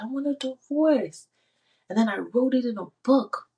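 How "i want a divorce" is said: high, sharp outcry again; yelled. Retold or imitated. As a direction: fling it out as a shout. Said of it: "I literally just yelled it like that".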